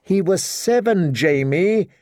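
berating. A narrator's reading. Queen rebukes Jamie over the boy, berating. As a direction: scold him for his folly. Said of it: "she berated him".

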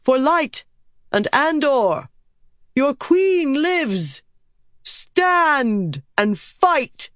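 high, drawn-out battle cries; rallying shout. A narrator's reading. Queen riding down battle lines to hearten men, shouting. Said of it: "shouting words that gave hope".

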